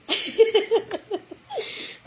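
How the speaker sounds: high-pitched helpless giggling; long, high laugh after saying thanks; real laugh. Real, not acted. [name] bashful at gifts, high laughing.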